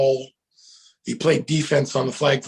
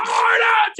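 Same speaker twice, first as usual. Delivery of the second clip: high, loud shouted answer; team roar reply; 5 dB louder than usual. Retold or imitated. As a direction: roar back as the whole team. Team's last answer.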